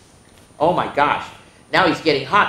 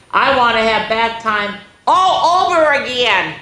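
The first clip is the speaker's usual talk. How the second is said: put-on grinning child; 7 semitones higher, 5 dB louder than usual. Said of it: "with a grin".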